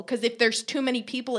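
normal talking voice

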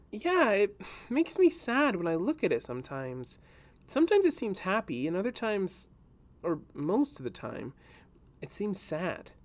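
put-on a girl trying to explain a sad feeling; young girl voice. A narrator's reading. Reyna telling Kadok about the root ball, gently sad.

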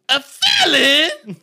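high, slow, shouted disbelief with a laugh; a loud stretched-out double take; an old man yelling "A felon?" at the paper; shocked "A felon?". Retold or imitated. Old man reading the background check.

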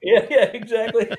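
loud and high, through real laughter; laughing agreement. Real, not acted. Guest agreeing with the host about the joke, laughing. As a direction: agree while still cracking up.

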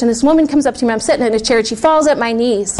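ordinary talk

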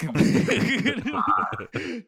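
bursting into high laughter; loud real laugh; big laugh. Real, not acted. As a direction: cracks up at the punchline. Laughing at own story.

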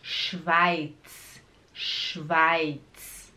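SCHWEIZ slowly, hushed SH and hissed final TS; drawn-out SCH and TS. Shown as a demonstration. Teacher modelling for learners, slow and exaggerated sounds. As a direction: stretch "Schweiz", finishing on a crisp TS. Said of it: "É assim mesmo".

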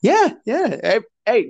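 ordinary talk, nothing marked

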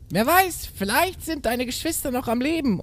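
very high theatrical character voice; goat mother's voice. A narrator's reading. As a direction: voice the mother goat with hammed-up hope. Goat mother's quote in the tale. (hoch gespielte Geißenmutter)

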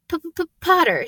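high-pitched, stuttering greeting; nervous stammer. A narrator's reading. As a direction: stick repeatedly on the first sound. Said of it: "stammered Professor Quirrell".

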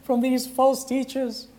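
high, pleading cry to the Lord; mother's desperate prayer. Retold or imitated. Monica begging the Lord for her son, high and pleading. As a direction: beg God like a frantic mother. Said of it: "And then she said".

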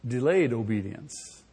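ordinary talk, nothing marked

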